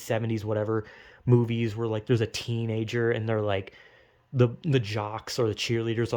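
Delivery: normal talking voice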